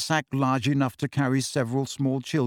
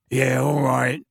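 mildly gruff concession; grunted admission; 3 dB louder, slower than usual. A narrator's reading. Big man admitting his errand, grunting. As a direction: give in with a grunt. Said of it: "he grunted".

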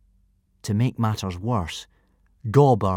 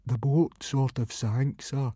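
softer, slightly slow miserable admission; 4 semitones higher, 4 dB quieter, slower than usual. Acted. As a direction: confess it in misery. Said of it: "said Fishlegs miserably".

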